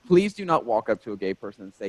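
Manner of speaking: ordinary talk, nothing marked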